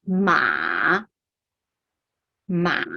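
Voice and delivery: ma che scende in basso e risale; third tone. Shown as a demonstration. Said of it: "it will go down and go up".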